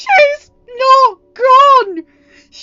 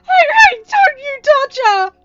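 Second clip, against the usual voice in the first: high, loud, slow warning through sobs; crying angry shout; 24 dB louder, slower than usual. Acted. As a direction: scream a warning through tears. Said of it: "Ryan exclaimed".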